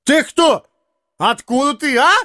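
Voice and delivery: high, blustering challenge; drunken roar. A narrator's reading. Drunk comic confronting the stranger, roaring. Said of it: "заревел он".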